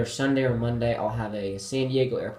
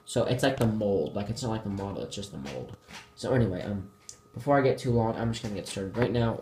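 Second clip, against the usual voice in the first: ordinary delivery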